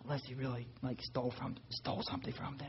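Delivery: breathy whisper, barely voiced. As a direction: whisper it as he used to talk. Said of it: "would not raise his voice".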